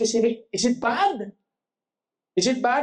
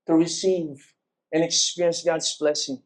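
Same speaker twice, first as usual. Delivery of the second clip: usual speaking voice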